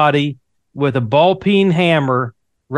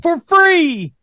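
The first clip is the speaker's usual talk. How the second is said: infomercial; 10 semitones higher than usual. Acted. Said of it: "We'll upgrade your target arrows".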